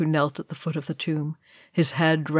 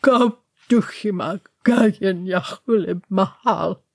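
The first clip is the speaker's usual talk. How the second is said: rasping Khuzdul chant; wrecked rasp; 2 semitones higher than usual. A narrator's reading. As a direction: rasp the old words solemnly. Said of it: "wrecked and rasping".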